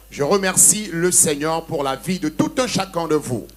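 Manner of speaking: usual speaking voice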